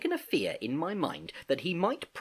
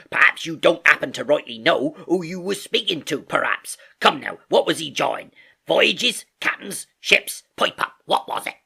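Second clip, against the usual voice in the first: loud, raised-pitch sailor interrogation; Silver haranguing; 5 semitones higher, 7 dB louder than usual. A narrator's reading. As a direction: hammer him with scornful questions. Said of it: "cried Long John".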